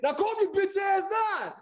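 slow, high mock shout; Derek yelling. Friend yelling at Tim to calm down, slow high shout. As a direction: bark it at him to calm down. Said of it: "And Derek be like".

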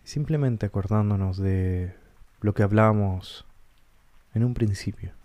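calm guided-meditation voice with long pauses. Acted. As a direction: guide listeners gently inward, leaving space between short phrases. Guía una meditación con pausas. (voz pausada de meditación guiada)

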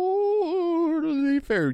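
high-pitched, drawn-out echo; high slow echo. Pretend. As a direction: stretch it out way up high. Echoing partner, high slow.

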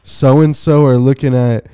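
slow, loud, deliberate report; retold tattling. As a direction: spell it out slowly for the teacher. Retells tattling to the teacher.

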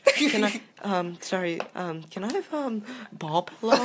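timid, hesitant shop request; awkward mock customer. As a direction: stumble through it, embarrassed. Friend voiced buying a pillow.

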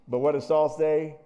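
ordinary talk

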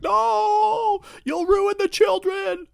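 very high, loud, drawn-out cry; slow hammed-up shriek of protest; mock outraged wail. Everyone horrified by the parrot's curse, mock shrieking. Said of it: "everyone's like".

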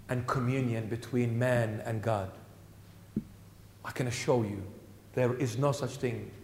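low, soft, intimate preaching voice; hushed preaching. Acted. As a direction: draw them in with a soft, low voice.

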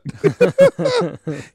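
loud high burst of laughter; high cackling laugh. Real, not acted. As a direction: laugh out hard at the punchline. Laughing at own story.

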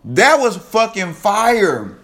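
loud, high whoa of amazement; hyped reaction. Real, not acted. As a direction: shout in amazement at the bar. Reacting to a bar.

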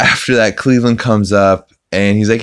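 ordinary delivery

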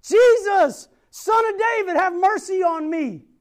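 loud pleading shout; yelling. Retold or imitated. Blind Bartimaeus yelling for Jesus again, pleading shout. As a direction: cry out over the crowd, begging. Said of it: "kept yelling".

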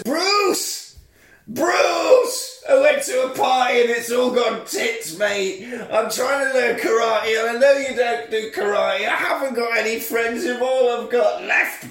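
high, hammed-up wail to Bruce Lee; a lonely teen begging at a grave; mock desperate plea. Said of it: "he says".

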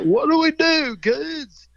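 loud, high Southern teacher's call; Bill Taylor. Retold or imitated. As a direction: call out to a class in a Southern accent. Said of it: "'Cause he had a Southern accent".

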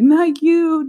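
high, loud mock retort. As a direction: punch it up in disbelief. Repeated retort, building up.

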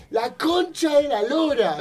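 high, slow shouted swear; shouted curse. Shown as a demonstration. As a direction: belt out the curse. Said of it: "Digamos, yo voy".

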